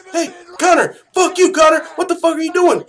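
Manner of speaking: high, loud, outraged shouting; acted angry yell. Fighter on the bus confronting Conor, acted angry yell. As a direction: yell at the attacker in mock outrage.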